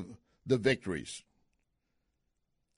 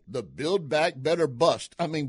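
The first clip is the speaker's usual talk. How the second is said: ordinary delivery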